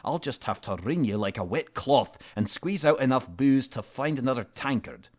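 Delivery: menacing hero threat; threatening. Acted. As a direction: scare the drunk into talking.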